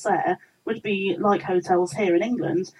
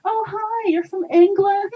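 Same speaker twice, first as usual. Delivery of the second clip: high, slow, louder put-on American accent; terrible American accent; 12 semitones higher, 6 dB louder, slower than usual. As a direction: overdo a bad American accent. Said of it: "A terrible American accent".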